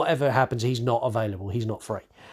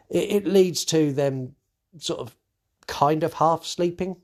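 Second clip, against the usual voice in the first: ordinary delivery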